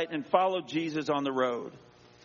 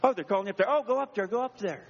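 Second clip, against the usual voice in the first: high, eager urging; mock enthusiasm, pitch jumping up; imitating the crowd; 6 semitones higher, faster than usual. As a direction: parrot a crowd suddenly keen. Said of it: "Going with the crowd".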